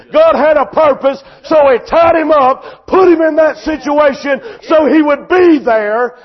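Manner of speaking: loud, driving sermon delivery; full-force preaching. Real, not acted. Preacher to congregation on troubles and the tied colt, full-force preaching. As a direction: preach it hard, punching key phrases.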